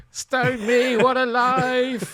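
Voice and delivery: high, loud, drawn-out comic exasperation; hammed-up Hancock despair; Tony Hancock. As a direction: wail it like a put-upon sitcom grump. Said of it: "he wakes up as Tony Hancock".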